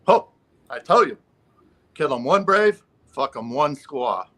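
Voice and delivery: joke Native character. Acted. First man answers the insurance lady, lightly hammed-up proclamation. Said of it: "he comes in".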